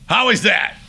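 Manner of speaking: high, punchy mock demand; angry challenge. Mad singer asks director about his take, acted irritation.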